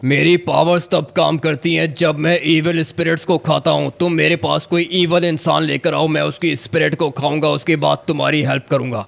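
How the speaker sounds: lightly voiced ghost laying down terms; even-pitched, commanding spirit voice. Ghost telling a man the price of help, commanding.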